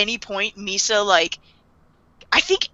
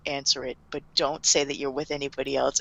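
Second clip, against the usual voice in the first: hushed instruction; 8 semitones lower, 11 dB quieter than usual. Retold or imitated. L instructing secretly.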